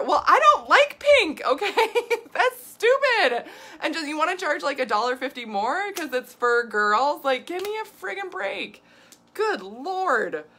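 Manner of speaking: loud, high, irritated ranting. Real, not acted. Vlogger venting at pink products, loud irritated rant. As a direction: vent your annoyance, loud and high. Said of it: "it makes me so irritated".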